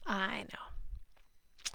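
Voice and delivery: unvoiced, very soft "I know" said to herself; a confiding little whisper; whispered aside. Real, not acted. Host whispers an aside to viewers about being opinionated, soft whisper.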